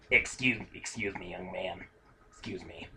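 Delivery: imitating a frail old customer; old lady voice. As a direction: croak it out like a little old lady. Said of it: "our old ladies sound almost exactly alike".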